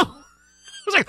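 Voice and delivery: slow laugh through the words. Real, not acted. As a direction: laugh while you say it. Laughs while telling.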